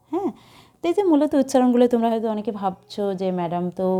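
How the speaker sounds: usual speaking voice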